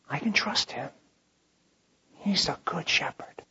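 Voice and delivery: softly whispered trust. Acted. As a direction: confide it quietly. Quiet personal confession of trust.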